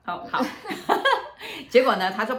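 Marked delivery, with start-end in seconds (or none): 0.5-1.6 a bright rising laugh; sudden high laugh. Real, not acted. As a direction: burst out laughing at the memory. Laughs at own story.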